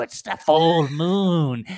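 slow, loud cry to the moon; howling dog-woman. Acted. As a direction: draw it out like a mad werewolf. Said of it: "snarls and says".

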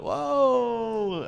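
high, drawn-out falling whoa; mock amazement. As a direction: stretch out a high-pitched mock-stunned exclamation. Mock shock at retold kiss.

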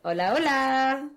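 slow, sensual drawn-out greeting; sultry woman. Acted. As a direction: purr the greeting like a seductress. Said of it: "Se escucha una voz sensual".

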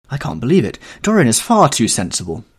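Basil objecting to Henry's news; disbelieving protest. Acted. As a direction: protest that it can't be true.

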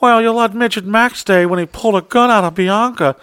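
high, mocking whine; mock his lame excuse in a high whine; whiny excuse. Imitating the fired employee's whiny complaint. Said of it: "all he could say is".

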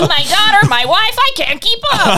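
high bumbling dad, half laughing; goofy clueless sitcom voice. Pretend. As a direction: play a dopey sitcom husband. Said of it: "Always clueless".

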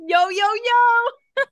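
slow, loud, high yo yo yo; excited entrance call. Acted. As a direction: stretch it out like a big entrance. Copying the guest's entrance.

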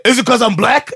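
high-pitched mock indignation; indignant accusation. Passenger to ticket inspector, indignant accusation.

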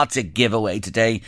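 ordinary talk

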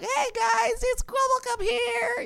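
put-on very high squeaky voice with a butchered English accent; squeaky kid voice. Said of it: "I have these squeaky videos".

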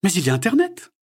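high, loud, kind question; Sophie's voice. A narrator's reading. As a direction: ask the girl gently curious. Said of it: "clin d'œil complice". (voix aiguë et curieuse de Sophie)

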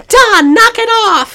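calling out. Retold or imitated. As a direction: shout across the boat at Don. She yells at Don on boat.

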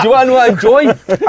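laughing question. Real, not acted. Laughing while asking his friends what he enjoys. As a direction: ask it again, giggling now.

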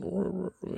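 imitated dog growl, high and soft; mock little dog snarl. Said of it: "you know, like a dog".

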